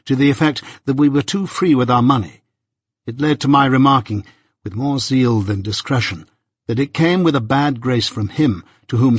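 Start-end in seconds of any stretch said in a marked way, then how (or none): none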